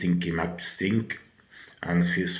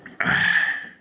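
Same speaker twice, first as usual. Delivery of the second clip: weary sigh. Real, not acted. Sick reviewer sighing.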